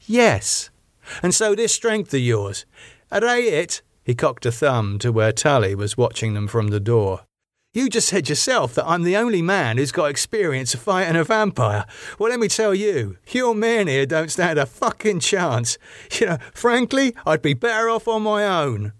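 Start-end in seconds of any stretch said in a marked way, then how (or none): 0.0-3.8 scornful challenge. A narrator's reading. David sneers at her guards. 7.7-18.9 high, heated character voice; scornful David. A narrator's reading. David dismissing Elizabeth's men as useless, scornful.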